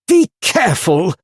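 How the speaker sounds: high, loud anxious plea; pleading. Acted. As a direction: beg them to be careful. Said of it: "pleaded Nigel".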